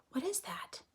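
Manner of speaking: hushed, wondering whisper. Real, not acted. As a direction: breathe the question like a secret. Wondering aside to the class.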